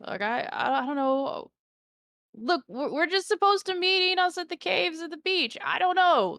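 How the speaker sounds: high, flustered half-orc; stammering, caught out half-orc. Acted. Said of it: "the half-orc says".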